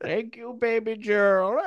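put-on very high, loud old man voice. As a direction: play a cheery old man. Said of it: "Can I do my Indian accent".

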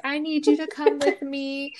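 high, pleading mock sadness; imitating tearful plea. Friend pleading at the goodbye hug, imitated tearful. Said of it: "saying all the saddest things".

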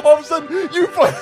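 usual speaking voice

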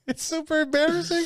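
very high, laughing mock shame; laughing embarrassed. Real, not acted. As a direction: squeal it through laughter.

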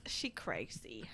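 whispered aside; breathy whisper, low. Real, not acted. Whispered aside about Mom.

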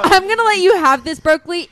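excited. Real, not acted. Laughing, excited concession.

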